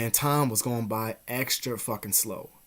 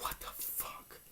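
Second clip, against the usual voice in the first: frightened whisper; 19 dB quieter, slower than usual. Retold or imitated. Spooked by a shadow in the covers, whispered. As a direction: breathe it out scared.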